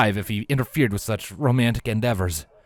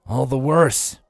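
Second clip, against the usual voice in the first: exaggerated despair; 5 semitones higher, 4 dB louder, slower than usual. Shining groans.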